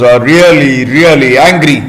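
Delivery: plain reading voice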